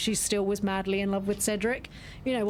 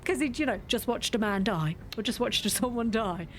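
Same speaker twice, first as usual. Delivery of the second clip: usual speaking voice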